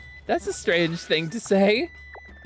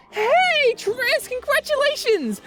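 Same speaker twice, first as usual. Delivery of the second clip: excited cheer; 14 semitones higher, slower than usual. Real, not acted. Cheering a chatter's milestone.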